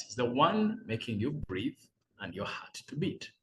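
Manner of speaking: normal talking voice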